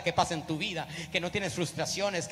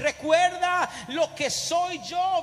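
builds from slow, firm urging to a higher shout; the Lord urging; 4 semitones higher, 4 dB louder, slower than usual. Acted. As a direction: start calm and slow, then rise into a loud, high cry. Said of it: "El Señor le dice".

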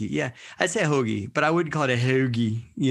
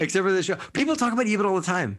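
mock high voice; 8 semitones higher, faster than usual. Mocking co-host's line in high voice.

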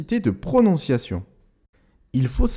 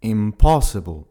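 "impossible" in English, lower, slower, POSS hit hard; stress on second syllable; 5 semitones lower, 3 dB louder, slower than usual. Shown as a demonstration. Teacher demonstrating English word stress slowly. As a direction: lean on POSS in "impossible", drawn out. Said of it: "l'accentuation se fait sur la deuxième syllabe".

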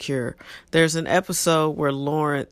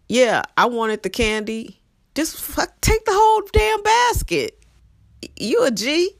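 hyped encouragement; 9 semitones higher than usual. Pretend. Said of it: "Just own it".

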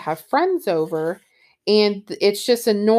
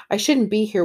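usual speaking voice

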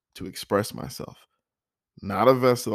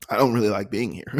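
laughing; 3 semitones higher, 4 dB louder, faster than usual. Real, not acted. Laughs at own discomfort.

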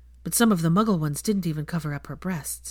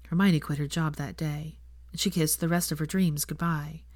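plain reading voice

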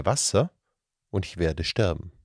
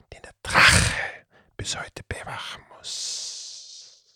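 whispering serpent creature; 2 semitones higher than usual. Acted. The Datzelwurm confiding the curse to the children, hushed whisper. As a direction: tell the legend in a secretive whisper. Creature tells the dragon's story.

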